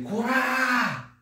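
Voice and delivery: high, drawn-out aggressive yell; anime battle cry. Shown as a demonstration. Teacher showing how anime fighters cry out, aggressive yell. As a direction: cry out high and stretch the final vowel. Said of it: "Dá esse grito".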